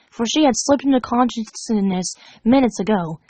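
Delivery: usual speaking voice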